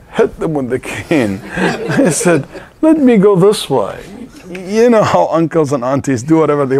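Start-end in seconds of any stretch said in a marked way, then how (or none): 2.8-4.0 put-on higher, louder insistent old woman pushing past; stubborn old aunt. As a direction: play the headstrong auntie who won't be told. Stubborn aunt pushes past angel.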